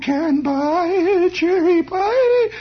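very high, slow, warbling mock soprano; insane record singer. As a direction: ham up a tall thin lady's shrill record voice. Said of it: "And then on came this voice".

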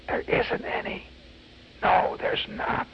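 sly stage whisper. Acted. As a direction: whisper it low, like a secret to a small child. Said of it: "whispering low to every tot".

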